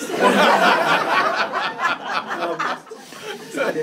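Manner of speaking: laughing. Retold or imitated. Father's solemn promise retold, laughing.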